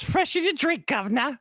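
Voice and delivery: very high cartoon cockney; Simpsons British accent. Quoting his favorite Simpsons casino episode, cartoon British accent. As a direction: squawk it in exaggerated British. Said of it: "my favorite Simpsons British accent".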